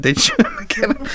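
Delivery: ordinary delivery, nothing marked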